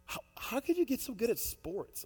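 put-on admiring girl. Mary questions Bubba admiringly.

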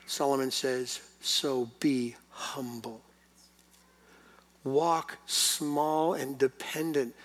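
each phrase drawn out slowly; slow emphatic preaching. Acted. Pastor calling church to humility, slow and deliberate. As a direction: lay it down word by word.

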